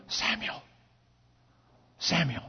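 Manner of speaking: hushed low whispered call; voice of God. Acted. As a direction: call his name softly from the dark. Voice of God calling at night.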